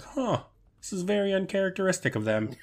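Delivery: high, mock-puzzled mom voice; imitating mom. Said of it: "and she's like".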